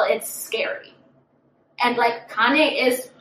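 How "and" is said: ordinary talk, nothing marked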